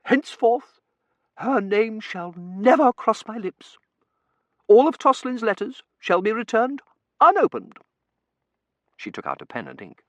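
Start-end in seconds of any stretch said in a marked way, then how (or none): 0.0-7.8 solemn outraged mother. Acted. Mother renounces eloping daughter.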